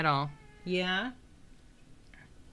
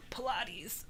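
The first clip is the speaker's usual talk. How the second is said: soft unvoiced whisper; 4 semitones higher, 11 dB quieter than usual. Real, not acted. Streamer whispering a joke aside under her breath. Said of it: "whispered aside".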